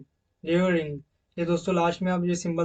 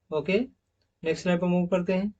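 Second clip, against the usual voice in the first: usual speaking voice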